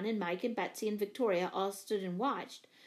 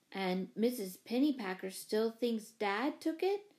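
usual speaking voice

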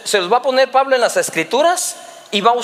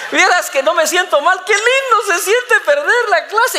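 high, gleeful son, hammed-up; mock-cheerful child; 8 semitones higher than usual. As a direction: gush in a put-on happy kid's voice. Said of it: "por qué estás tan alegre".

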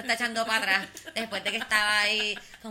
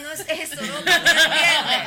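laughing; 7 semitones higher, 13 dB louder than usual. Real, not acted. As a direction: protest while cracking up. Protesta riéndose. (protestando entre risas)

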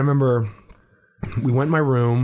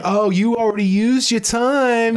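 mock old lady; 10 semitones higher than usual. Imagining her announcing time is up.

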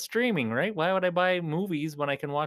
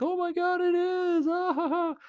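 high mocking laugh, much higher; imitating laughing kids. Vlogger imitating kids laughing at his car, high mock laugh. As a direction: squeal it like a kid laughing at you. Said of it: "they just started laughing like".

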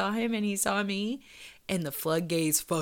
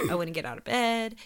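ordinary delivery

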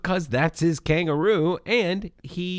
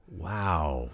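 whispered wow; 12 semitones lower, 5 dB quieter than usual. Real, not acted. After the character is kicked out.

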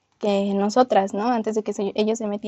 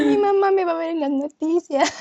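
laughing mock dread; 7 semitones higher, 3 dB louder than usual. Fear of mom seeing news.

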